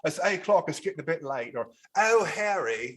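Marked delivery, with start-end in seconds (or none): 0.3-1.6 Harry Potter-style English accent, louder and higher; broad, punchy working English accent; lower English accent. Shown as a demonstration. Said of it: "there's lower English, like Harry, I call it Harry Potter". 2.0-2.9 high, loud Harry Potter English; broad English accent, swooping up; lower English accent. Shown as a demonstration. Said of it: "I call it Harry Potter".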